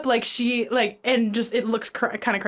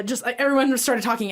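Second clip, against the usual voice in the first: ordinary delivery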